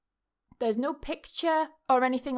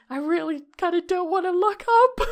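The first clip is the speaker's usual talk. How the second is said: scared thought; 6 semitones higher, 7 dB louder than usual. Retold or imitated. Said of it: "But I was thinking".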